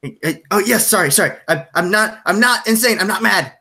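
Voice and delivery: high, insistent, flustered protest; agitated Jack. Acted. Jack to the player he grabbed, agitated apology and protest.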